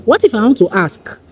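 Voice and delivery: ordinary delivery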